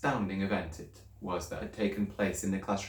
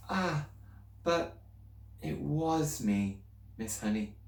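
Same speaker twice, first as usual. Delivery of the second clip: put-on higher, anxious little girl's voice; girl pleading with her teacher, higher and anxious; young Matilda; 6 semitones higher, 3 dB quieter, slower than usual. A narrator's reading. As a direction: speak up as a worried schoolgirl.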